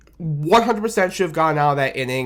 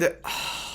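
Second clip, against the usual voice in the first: weary sigh; 11 dB quieter than usual. Real, not acted. Before recounting the ninth inning.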